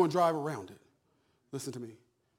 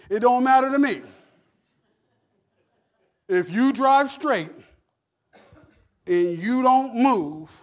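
louder, slowing right down on each word; emphatic preaching shout; 6 semitones higher, 11 dB louder, slower than usual. Real, not acted. Pastor shouting the point to his church, slow and loud. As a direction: hammer it home to the congregation.

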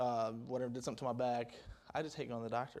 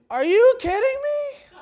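high, loud disbelieving yell; loud, high-pitched exasperation; exasperated outburst; 12 semitones higher, 8 dB louder than usual. Acted. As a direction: shout it, fed up and incredulous. Acts out finding the empty tank.

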